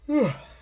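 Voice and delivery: a big tired sigh out loud; weary sigh before speaking again. Real, not acted. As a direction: let out a heavy audible sigh. Heavy sigh after long pause.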